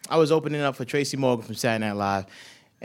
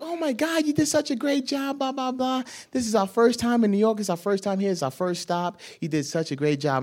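high, breathless excited lady; gushing tourist lady; 10 semitones higher than usual. Retold or imitated. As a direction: gush like a starstruck tourist. Tourist ladies gushing over comedian.

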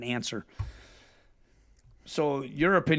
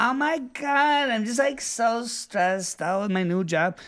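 mock stressed whiner; 8 semitones higher than usual. Mocking people who complain about their job, high whiny voice. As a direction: mimic a self-pitying young worker, pitched way up.

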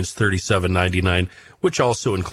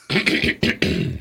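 throat clearing; 10 semitones higher than usual. Real, not acted. Host clearing his throat before going on. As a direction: clear the throat hard, off mic. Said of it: "hold on as I close my mic and clear my throat".

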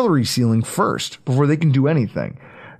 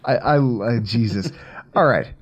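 after laughing hard; 4 semitones lower than usual. Real, not acted. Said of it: "I'm back".

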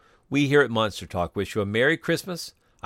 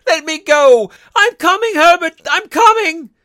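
put-on screaming old woman, very high; shrieking to a son outside; frantic cry; 13 dB louder than usual. A narrator's reading. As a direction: call out desperately at full volume. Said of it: "she cried, struggling".

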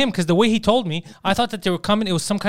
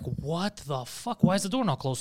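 slow disbelief; 8 dB quieter, slower than usual. Retold or imitated. Man finding the door left open, slow quiet disbelief. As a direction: stretch each word out softly in disbelief.

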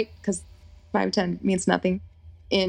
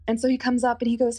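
usual speaking voice

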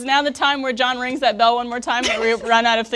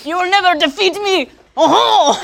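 higher, louder hammed-up duel taunt; mock battle cry; 6 semitones higher, 6 dB louder than usual. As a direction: declare victory like a pompous duelist. Acted French aristocrat duel taunt.

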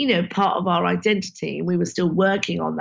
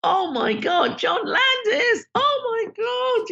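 high, slow mock shriek of a fan; imitating her own thrilled squeal at the invite; starstruck shriek; slower than usual. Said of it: "I was like".

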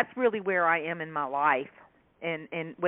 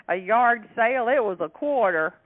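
louder proud Southern country voice; country accent; 4 semitones higher, 6 dB louder than usual. Retold or imitated. Shopper bragging to the author, proud country voice. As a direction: brag it like a bargain hunter. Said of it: "She said".

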